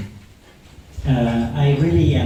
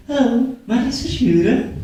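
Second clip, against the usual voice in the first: a much higher, sweet telephone greeting; high feminine phone voice; 10 semitones higher than usual. Shown as a demonstration. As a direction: answer the phone in your most feminine voice. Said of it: "it goes like this".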